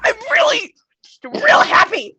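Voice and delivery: squeaky, strained high cheerfulness; forced happy. Acted. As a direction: force the happiness, pitch way up. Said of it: "you don't sound happy".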